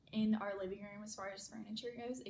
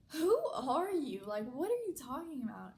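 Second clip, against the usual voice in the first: incredulous; 4 semitones higher, 6 dB louder than usual. Retold or imitated. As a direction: demand it as if utterly baffled. Stunned reply to mother's odd remark.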